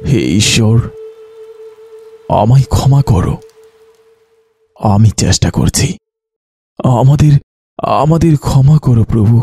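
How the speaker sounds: halting, stricken plea for forgiveness; anguished prayer. Acted. Doctor begging God's forgiveness after losing patients, anguished prayer. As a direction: plead with God, pitched a little up.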